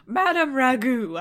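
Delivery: loud, slow, pompous; mock formal address. King grandly addressing the fairy, mock formal. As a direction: announce her title grandly.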